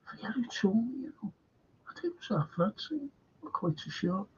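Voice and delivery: hushed, breathy aside; whispered confidence. Real, not acted. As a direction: lean in and whisper it. Confiding who told him.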